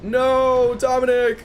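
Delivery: high loud cry of dismay; anguished shout. Real, not acted. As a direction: yell as he falls. Ally going down in game.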